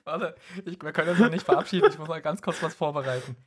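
high, fast, giggling; breathless giggling rush; giggly late night call. Real, not acted. Giggling, rushing to prepare farewell. (kichernd, hastig)

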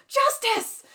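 high, loud imitated battle cry; mock yell. Imitating a heroine with a dagger aloft, yelled. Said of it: "like, yells "justice".

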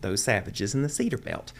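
over-enunciate every word ending like a proper Houston Texan; over-enunciated Houston accent. Shown as a demonstration. Son mimicking his Houston mother, over-enunciated Texas accent. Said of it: "they're gonna over-enunciate the end of words".

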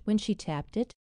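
usual speaking voice

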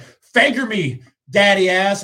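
high, loud, slow catchphrase call; mock catchphrase. Retelling yelling the catchphrase to his league. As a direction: belt it like a crowd chant. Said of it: "So last night I was like".

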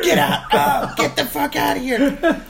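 high, loud mock bellow; agonized acted shouting, drawn out; pained yelling. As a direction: yell them away between cries of pain. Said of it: "yelling at the top of my lungs".

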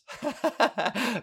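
short giggle rising high; high laugh. Real, not acted. Reading the term for erections: real giggle. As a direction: burst out laughing.

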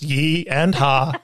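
slow, "yee" then "ha" apart; split into syllables. Shown as a demonstration. Explaining the yeehaw to union officials, split slowly. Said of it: "it's two syllables".

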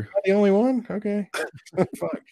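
high woozy dizzy question; dizzy, checking if others feel it. Real, not acted. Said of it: "I feel like I might throw up".

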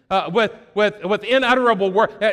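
ordinary talk

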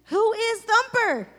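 high, loud and slow, baffled; shocked disbelief at a silly name. Retold or imitated. Baffled reaction to nickname.

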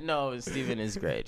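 ordinary talk

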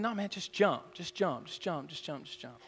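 softly repeated, fading almost to nothing; imitated coaxing dad. Dad under the tree urging son to jump, quiet coaxing. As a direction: coax quietly, letting each repeat drop away.

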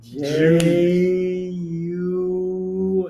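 letters held very long; hesitant spelling. Retold or imitated. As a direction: stretch each letter out endlessly. Spelling juice with stalled confidence.

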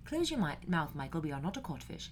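soft mock-British nanny scolding; Mary Poppins. Podcaster testing whether a film nanny is British, quoting her in British accent. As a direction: put on a quiet, prim British accent. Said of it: "Yeah, no, she's British".

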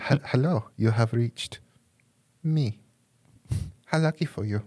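campy German villain answering the phone. Acted. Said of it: "This is how I answer the phone".

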